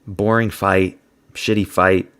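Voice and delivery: ordinary talk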